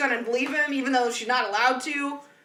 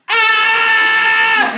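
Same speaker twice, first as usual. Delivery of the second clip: a sudden loud startled yell, imitating Jared; 8 semitones higher, 10 dB louder than usual. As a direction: blurt out a loud startled yell, as if scared by a horse and buggy. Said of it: "all Jared did as we passed him was".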